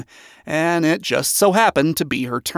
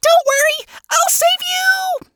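screeching. A narrator's reading. Tiny housekeeper dashing knife in hand, screeching. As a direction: screech it as you dash to the rescue. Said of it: "Nifty screeched out".

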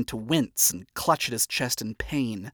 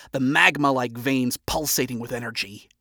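plain reading voice

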